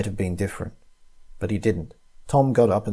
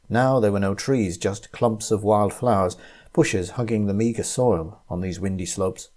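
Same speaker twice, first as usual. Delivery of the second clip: usual speaking voice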